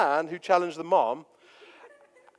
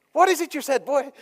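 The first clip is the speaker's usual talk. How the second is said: much higher, louder mock Irish tough-guy challenge; Irish fighter squaring up, high and loud; Conor McGregor Irish; 12 semitones higher, 7 dB louder than usual. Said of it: "just imagine that was Irish".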